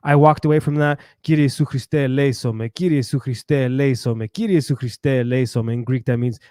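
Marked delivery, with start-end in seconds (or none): 1.2-5.7 low, repetitive murmured-style prayer; recited prayer. Retold or imitated. Recites prayer he said walking away.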